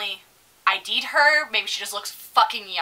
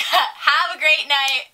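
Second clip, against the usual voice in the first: higher, drawn-out sing-out goodbye; mock-cheery farewell; 5 semitones higher, 4 dB louder, much slower than usual. Server to the table that left no tip, mock-cheery. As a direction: overdo a sweet customer-service goodbye, slowly.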